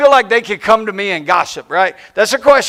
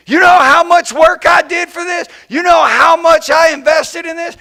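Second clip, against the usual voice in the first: mock indignant shout, higher and louder; offended outburst; 6 semitones higher, 4 dB louder than usual. Preacher imitates an offended churchgoer, indignant shout. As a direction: play the hurt, unthanked worker yelling. Offended person demanding thanks.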